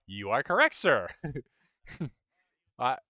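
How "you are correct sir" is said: loud, playful concession to a critic; mock agreement. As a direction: own up with amused emphasis. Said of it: "And I'd be like".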